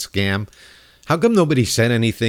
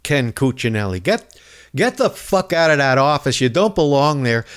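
normal talking voice

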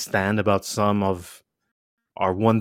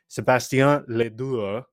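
put-on French-Italian accent; 3 semitones higher than usual. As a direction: imitate an eloquent French-Italian accent, drawn out. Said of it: "French slash Italian accent".

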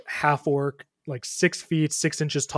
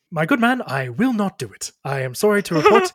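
higher, loud, formal refusal; Ptolemy; 5 semitones higher, 6 dB louder than usual. Acted. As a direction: stand firm as an indignant musician. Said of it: "to Ptolemy once more".

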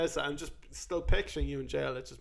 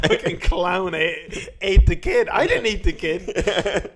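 loud, laughing mock protest, higher; mock prisoner protest; 4 semitones higher, 11 dB louder, slower than usual. Prisoner insists to the table, loud and laughing. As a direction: yell the excuse while cracking up. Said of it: "everyone's like".

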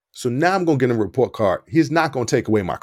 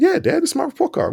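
put-on much higher, anxious kid insisting; nervous protest. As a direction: squeak it out like a guilty kid. Son nervously defends fake card.

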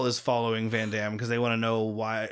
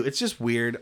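ordinary talk

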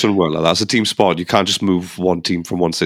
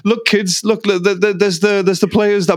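much higher, gushing tour-guide voice; mock excited owner; 11 semitones higher than usual. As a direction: squeal it like a starstruck dad showing the kids around. Mocking owner showing kids players.

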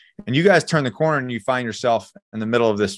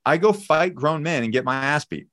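ordinary talk

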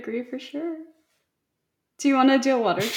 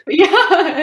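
laughing; 9 semitones higher, 11 dB louder than usual. Real, not acted. Laughs while answering.

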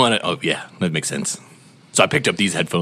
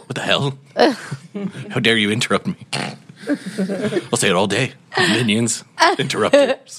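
mock villain outrage; 6 semitones higher than usual. Villain scolds interruption.

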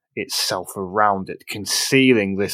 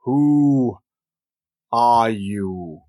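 deep booming voice; much slower than usual. A narrator's reading. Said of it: "deep booming voice call out".

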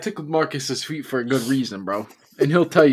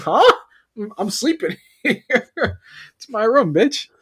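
incredulous; 8 semitones higher, 4 dB louder than usual. Retold or imitated. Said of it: "I'm like".

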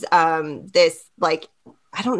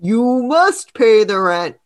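villain voice; 2 semitones higher, 4 dB louder than usual. Acted. Villain line, second take.

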